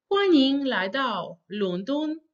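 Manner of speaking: "欢迎来到伦敦" slowly, each tone distinct; pronunciation demo. Shown as a demonstration. Teacher models welcoming somebody, "欢迎来到伦敦" slow and deliberate. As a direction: start "欢" high and level, unhurried through "伦敦". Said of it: "pronounce the sentence is like this".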